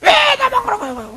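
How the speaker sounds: put-on petulant child's protest; whiny child. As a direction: pout like a kid who feels picked on. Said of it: "너무 리얼한가요?".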